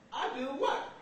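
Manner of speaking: high-pitched mock disbelief; incredulous little-boy question; baffled kid. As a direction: act a child caught by surprise. Child baffled by father's answer.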